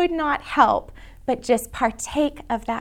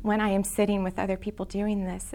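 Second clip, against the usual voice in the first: a friend confiding softly on the phone; retold hushed voice; 3 semitones lower, 7 dB quieter than usual. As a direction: confide it like a secret. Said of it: "And she said".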